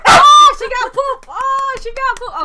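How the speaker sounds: high, loud, drawn-out excitement; real high-pitched excited cry; excited squeal. Real, not acted. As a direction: squeal it out slowly to hype up the dog. Excited reaction to dog responding.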